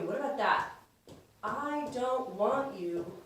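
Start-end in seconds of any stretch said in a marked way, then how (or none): 1.4-3.2 slow, softer, stretched-out words; slow dictation. Shown as a demonstration. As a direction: say it slowly while writing it down. Slowly voices words while writing them.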